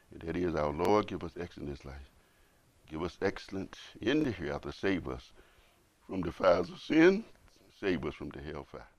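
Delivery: soft, low prayerful voice; quiet prayer. Acted. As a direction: hush it like a closing blessing. Translates dua softly.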